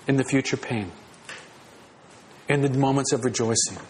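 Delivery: pastor voicing God's quiet promise to stay near; tender promise. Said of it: "God personally comes to us in Christ and says".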